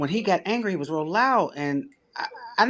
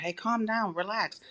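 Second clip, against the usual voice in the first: soft, higher-pitched soothing plea; calming; 6 semitones higher, 8 dB quieter than usual. Retold or imitated. Telling an angry man to relax, softly soothing. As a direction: gently try to settle someone down. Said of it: "I kept telling him".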